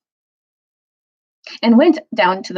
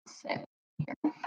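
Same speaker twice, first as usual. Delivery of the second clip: usual speaking voice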